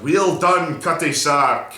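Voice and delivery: loud, high triumphant roar; drunken farmer roaring his approval; roaring cheer. Acted. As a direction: bellow it out like a man carried away. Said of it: "roars out".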